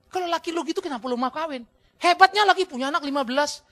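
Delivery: mock the wife, pointed and loud; scolding. Real, not acted. Preacher scolds wives who insult husbands, loud teasing rebuke.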